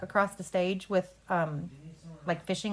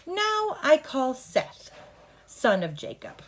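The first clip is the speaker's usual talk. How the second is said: slow, high, announcing the witness; formal courtroom call; 6 semitones higher, slower than usual. Shown as a demonstration. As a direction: call the witness like a lawyer, slowly. Coach demos courtroom line.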